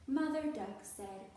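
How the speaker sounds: plain reading voice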